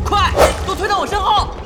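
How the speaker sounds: high, loud urgent order; rally the team behind you; battle command shout. Acted.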